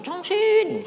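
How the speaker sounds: loud call. Retold or imitated. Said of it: "Suaranya itu keras sekali".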